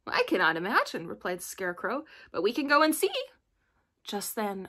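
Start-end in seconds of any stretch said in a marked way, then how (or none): 0.0-1.1 louder, lively Scarecrow voice; lightly voiced animated Scarecrow. A narrator's reading. Scarecrow answering Dorothy about the groan, lively and loud. 2.3-3.1 higher, louder Scarecrow voice; eager, rising suggestion from the Scarecrow. A narrator's reading. Scarecrow proposing they go and look, lively and loud.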